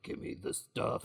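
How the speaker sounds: villain voice. Acted. Big man demanding the stuff from Jeeves, villain voice. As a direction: rasp it as a mock thug.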